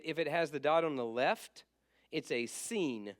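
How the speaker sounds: normal talking voice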